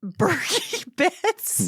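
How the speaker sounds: high, drawn-out laughing echo; amused disbelief. Real, not acted. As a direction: repeat it incredulously through a laugh. Incredulous echo of new term.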